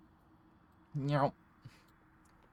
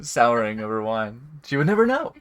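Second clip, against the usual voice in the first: scheming NPC voice; 10 dB louder than usual. Acted.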